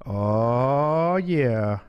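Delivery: ordinary talk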